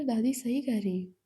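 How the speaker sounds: ordinary delivery, nothing marked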